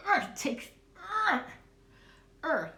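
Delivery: playful effortful grunting from the guides; guides' grunts. Retold or imitated. Spirit guides hauling things up, hammed-up grunts. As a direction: heave it up with mock strain. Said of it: "they're going".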